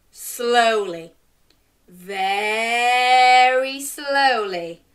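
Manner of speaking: stretched, very slow words; slowly. A narrator's reading. Turnip beginning to move, drawn out slowly. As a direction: drag it out slowly.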